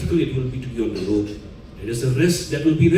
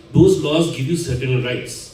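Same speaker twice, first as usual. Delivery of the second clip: ordinary talk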